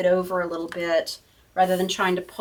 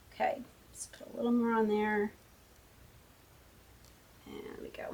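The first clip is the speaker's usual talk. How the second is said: whisper to self; 11 dB quieter than usual. Real, not acted. Murmuring to herself while gluing.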